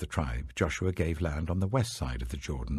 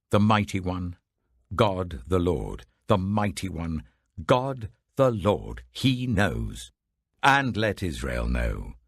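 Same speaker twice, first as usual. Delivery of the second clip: slow, louder, weighty invocation; solemn oath; 5 dB louder, slower than usual. Acted. Tribes swearing innocence before the clan heads, slow solemn declaration. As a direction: proclaim each name of God deliberately.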